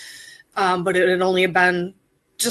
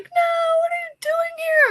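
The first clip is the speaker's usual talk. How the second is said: much higher, shocked cry of disbelief. Retold or imitated. Wife spotting her surprise husband in Vegas, shocked high cry. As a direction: replay the moment you saw him. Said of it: "I was so shocked".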